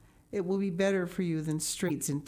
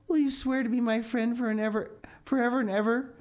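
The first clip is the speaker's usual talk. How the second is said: higher and fast, tumbling out; eager demand; 8 semitones higher, 4 dB louder, much faster than usual. A narrator's reading. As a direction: rush the promise out, eager and urgent. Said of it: "demanded Anne eagerly".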